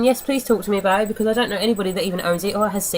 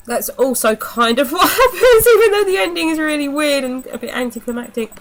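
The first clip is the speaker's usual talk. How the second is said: laughing through the words, pitched up; chuckling at how silly the ending is; 6 semitones higher, 3 dB louder than usual. Real, not acted. Reviewer laughing while telling viewers the ending was weird.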